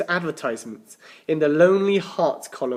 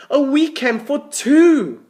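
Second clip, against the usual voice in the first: slow thrilled exclamation; 12 semitones higher, 8 dB louder, slower than usual. Acted. Tim thrilled by prize.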